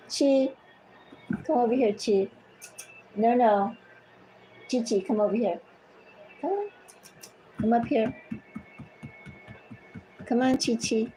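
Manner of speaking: short, high calls to a pet; calling a cat. Real, not acted. Calling the cat back to her.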